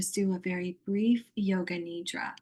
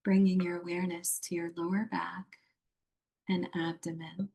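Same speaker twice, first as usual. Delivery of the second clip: guided meditation. Acted. Yoga teacher guiding a muted online group into yoga nidra, meditative. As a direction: lead them gently into rest, phrase by phrase.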